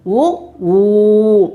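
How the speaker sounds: brief ఉ, then ఊ held long and level; teacher sounding out ఉ short and ఊ long. Shown as a demonstration. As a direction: contrast a quick ఉ with a drawn-out ఊ. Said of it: "అవి ఏమేమి అక్షరాలో చూద్దాం".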